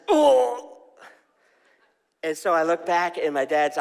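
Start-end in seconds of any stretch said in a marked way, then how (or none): 0.1-0.8 sudden high, loud "Oh!" of a hurt man; retold cry of pain. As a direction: imitate the dad's pained cry, much higher and louder. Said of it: "all I heard is this".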